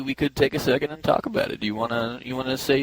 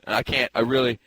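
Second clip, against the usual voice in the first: ordinary talk, nothing marked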